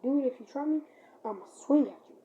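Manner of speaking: loud warning, ready to swing; threatening yell. Retold or imitated. To the man walking up, a loud angry threat. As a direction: warn him off, loud and tense.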